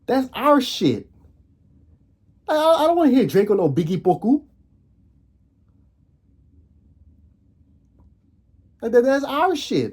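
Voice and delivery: high-pitched passionate outburst; heated. Real, not acted. To viewers about [name], high heated protest. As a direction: defend your culture, voice shooting up.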